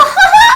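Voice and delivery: loud, high squeal of laughter. Real, not acted. Cracking up at her own pun, loud high squeal.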